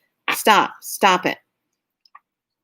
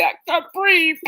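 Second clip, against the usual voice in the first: much higher, mock gasping panic; 10 semitones higher than usual. Tourists off the plane in humid heat, mock breathless panic. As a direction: play up the breathless shock. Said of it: "They stand there going".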